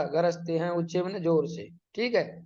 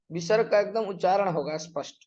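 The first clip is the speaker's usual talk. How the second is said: ordinary delivery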